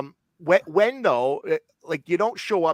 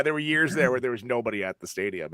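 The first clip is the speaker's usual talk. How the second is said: normal talking voice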